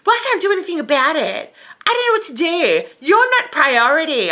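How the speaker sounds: high-pitched mocking sass; sassy. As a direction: imitate a sassy staffer brushing someone off. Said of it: "she fucking sassed me".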